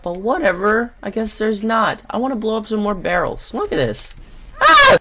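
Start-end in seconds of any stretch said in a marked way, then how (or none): 4.6-5.0 short, very high, loud yelp; startled scream. Real, not acted. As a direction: yelp in sudden alarm. Startled yelp during play.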